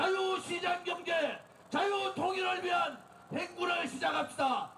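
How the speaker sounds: rousing shouted rally speech. Real, not acted. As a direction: rouse the crowd to march. (힘차게 외치는 집회 연설)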